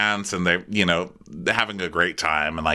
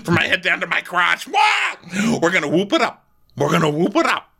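higher mock party voice; hint of tipsy Vicky; 9 semitones higher, faster than usual. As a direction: cheer it like a giddy drunk. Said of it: "So she's like".

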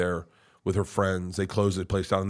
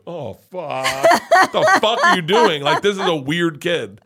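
loud, high, laughing disbelief; amused disbelief; 7 semitones higher, 8 dB louder than usual. Retold or imitated. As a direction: blurt it out through laughter. Reacting to precocious child, laughing.

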